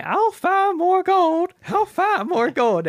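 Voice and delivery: put-on high, slow cackling old prospector voice; goofy prospector. Stinky Pete insists to the town, slow and high old coot. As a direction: stretch it out like a crazy old gold miner. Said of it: "he keeps on saying".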